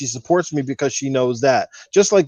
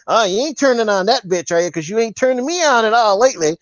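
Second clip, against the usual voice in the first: put-on high, sassy girlfriend voice; mock jealous girlfriend; 6 semitones higher than usual. Mimicking jealous girlfriend mocking the Xbox.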